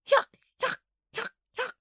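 very high, sharp wheezy cackle; old lady's choking high cackle; wheezing hiccuping laugh. A narrator's reading. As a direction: hiccup out a laugh in separate bursts. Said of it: "making the most awful wheezing, hiccuping noise".

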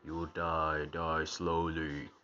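slow, sinister mock house voice; haunted house voice. Viewer mimicking the house threatening the family, slow eerie voice. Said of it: "I like how the house is speaking".

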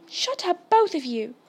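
cutting order that starts high; brisk, narrated rebuke; sharp. A narrator's reading. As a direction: silence the squabblers sharply. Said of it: "said Harry sharply".

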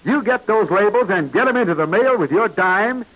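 ordinary delivery

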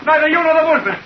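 high, strained accusing cries; paranoid angry shouting. Acted. Simon shouting at Lindley that he will not have his secret. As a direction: hurl the accusation, half mad with fear.

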